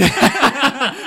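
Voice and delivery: big high laugh bursting out; real loud laughter. Real, not acted. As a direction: crack up at the text. Host laughs at Aaron's text.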